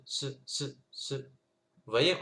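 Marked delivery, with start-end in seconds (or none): 0.1-1.1 ce, ce: un s soufflé sans voyelle; bare s sound. Shown as a demonstration. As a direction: siffler le s et s'arrêter. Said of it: "ça donne tout simplement ce". (s seul, sans voyelle)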